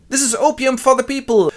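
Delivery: high, loud agitator's cry; impassioned shout. Acted. John denounces soma to the workers, impassioned shout. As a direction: proclaim it to a crowd at high pitch.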